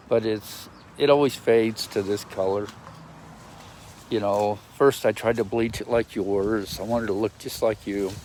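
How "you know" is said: ordinary delivery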